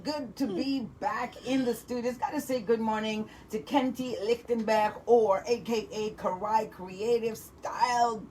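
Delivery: usual speaking voice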